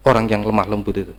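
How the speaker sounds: normal talking voice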